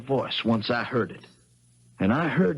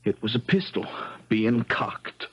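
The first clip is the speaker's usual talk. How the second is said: ordinary talk, nothing marked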